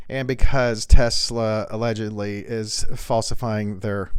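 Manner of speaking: usual speaking voice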